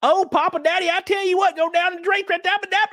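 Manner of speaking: very high, cartoonish Southern girl voice; mock Southern accent. Streamer mocking an Ozark actress's accent, exaggerated Southern. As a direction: squeal it as an overdone Southern caricature. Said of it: "in Ozark, she's like".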